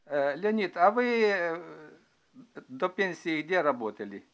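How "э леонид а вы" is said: usual speaking voice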